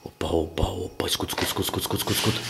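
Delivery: nervous night vlog whisper. Real, not acted. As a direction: whisper so the spirit won't hear. Hears noise, whispers. (sussurro nervoso à noite)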